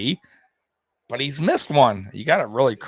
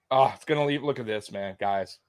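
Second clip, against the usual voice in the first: usual speaking voice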